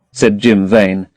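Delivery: ordinary delivery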